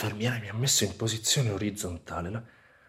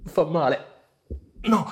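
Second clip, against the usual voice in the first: in pain; 5 semitones higher, 5 dB louder than usual. Acted. Pain after explosion, hurting.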